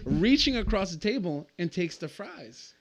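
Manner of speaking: incredulous retelling, still amused; excited. Real, not acted. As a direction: tell it like you can't believe it. Retelling outrageous moment, excited.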